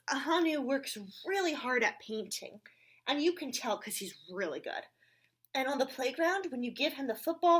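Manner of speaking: lively read-aloud with character voices; reading to children. Acted. Reading a picture book aloud to children, animated. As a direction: read it brightly to the little ones.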